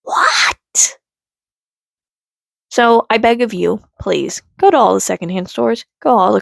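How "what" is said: high voiceless gasp of "what"; breathy astonished what; whispered disbelief. Real, not acted. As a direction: gasp it in amazement. Mock disbelief.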